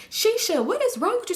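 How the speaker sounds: high-pitched indignant questioning; exasperated scolding. Retold or imitated. Friend confronting Shisha on the phone, exasperated and high.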